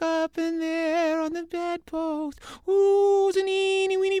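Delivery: high, swelling ghost voice. Acted. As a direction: wail it out like a ghost working up a scare. Ghost tells the story.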